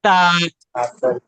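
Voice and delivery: ता said firmly from the tongue tip for practice; model ता. Shown as a demonstration. Teacher sounds ता for students to practise. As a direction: give a firm ता from behind the teeth. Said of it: "इसकी भी practice कर लें आप".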